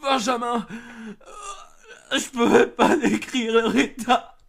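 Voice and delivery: high, loud, slow words through sobs; hysterical sobbing. Acted. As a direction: weep and half-laugh in shock. Sobbing over the victims.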